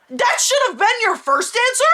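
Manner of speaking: high sarcastic jab; sarcastic retort. Retold or imitated. Patient snapping back at the receptionist, high sarcasm. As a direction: needle her with mock disbelief.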